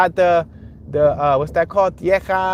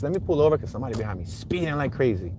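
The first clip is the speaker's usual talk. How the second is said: ordinary talk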